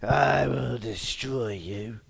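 very slow put-on villain threat; mock menacing villain. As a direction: stretch out every word with hammed-up menace. Villain threat within impression bit.

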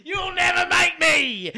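slow, high taunting yell; high taunting shout. Acted.

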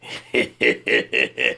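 dark villain chuckle; evil cackle; sinister laugh. Acted. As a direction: laugh like a schemer. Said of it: "That's the sinister laugh".

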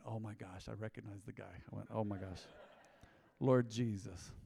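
hushed, low groan of dread under the breath; dismayed mutter. Retold or imitated. The driver, seeing the same cop again, mutters low. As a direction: murmur it like spotting trouble in the mirror.

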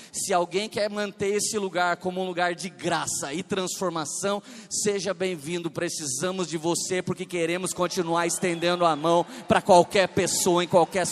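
loud, driving sermon delivery; full-force preaching. Real, not acted. Pastor welcoming any sinner in Jesus' name, full-force preaching.

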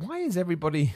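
mock self-pity, why is everyone so mad at me; exasperated whine. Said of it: "you're just a bit like".

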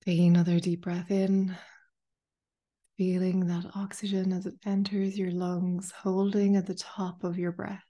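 calm meditation guide, long pauses between phrases; unhurried, spacious guided-meditation delivery. Acted. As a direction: ease listeners into stillness, phrase by phrase. Leads a guided meditation after chat.

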